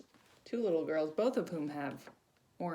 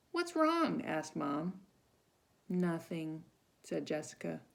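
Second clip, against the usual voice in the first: storybook reading; 4 semitones higher, 6 dB louder than usual. Acted. Reads picture book to children.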